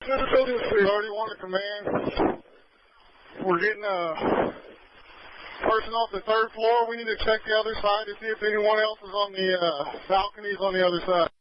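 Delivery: high, strained rescue report; pushing words out mid-rescue; urgent firefighter radio. Real, not acted. Crew pulling victim off third floor.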